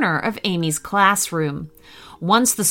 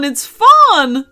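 loud cheerful repeat; cheery dinosaur; 5 semitones higher, 7 dB louder than usual. Acted. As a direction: settle the second fun down. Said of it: "said the dinosaur cheerily".

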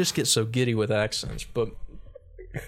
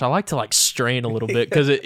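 ordinary delivery

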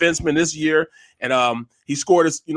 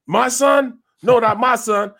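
high-pitched indignant denial; mock parental disbelief; 7 semitones higher, 3 dB louder, slower than usual. Dad told his son is stealing, imitated indignant disbelief. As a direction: play the affronted father, pitched up.

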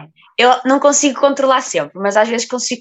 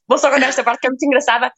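ordinary delivery

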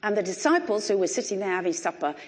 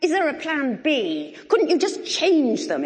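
frustrated prayer; 6 semitones higher, 4 dB louder than usual. Retold or imitated. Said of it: "I found myself praying".

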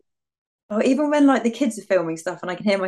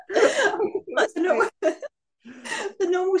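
helpless giggly start, higher pitched; laughing; 9 semitones higher, much slower than usual. Real, not acted. Friend confessing why she changed Siri's voice, laughing. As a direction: talk through a fit of giggles.

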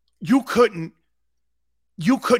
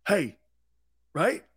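ordinary talk, nothing marked

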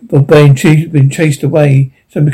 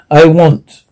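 ordinary delivery

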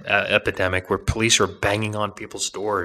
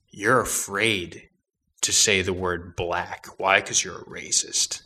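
slow mocking punchline; slower than usual. Mock accusatory punchline.

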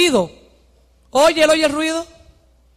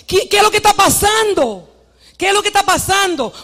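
high, urgent questioning; blind man asking; 7 semitones higher, 4 dB louder than usual. Acted. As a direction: call out to find out what is happening. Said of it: "y él pregunta".

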